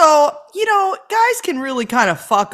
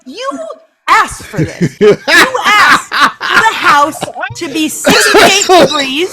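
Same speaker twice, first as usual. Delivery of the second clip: louder, slow laughing exasperation; louder laughing rant at the husband; 6 semitones higher, 4 dB louder, slower than usual. Real, not acted. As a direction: pile it on, laughing.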